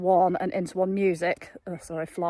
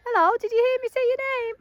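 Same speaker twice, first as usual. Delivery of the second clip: high horse-talk voice; 5 dB louder, faster than usual. Real, not acted. Owner greeting her mare in a high pet voice. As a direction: sing it up high like talking to a pet.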